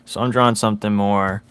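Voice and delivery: ordinary talk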